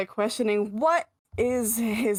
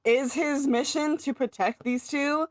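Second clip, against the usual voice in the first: higher-pitched, sarcastic imitation of a question; mildly mocking, raised-pitch question; sassy sarcasm; 6 semitones higher than usual. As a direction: quote her as sassy and skeptical. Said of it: "she is just being sassy and sarcastic".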